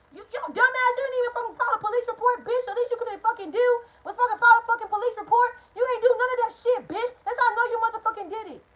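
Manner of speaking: high, fast berating yell as mom; furious mother. Retold or imitated. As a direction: tear into her like an enraged mother. Mom berates her over police report.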